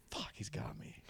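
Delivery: retold whispered curse; whispered dread. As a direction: whisper it to yourself, acting caught out. Retelling his thought on being blackmailed.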